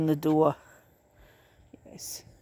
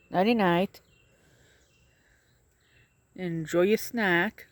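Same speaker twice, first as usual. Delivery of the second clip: gentle send-off; slower than usual. Real, not acted. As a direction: say it slowly and tenderly to the animals. Soft slow goodbye to animals.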